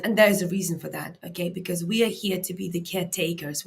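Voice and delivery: normal talking voice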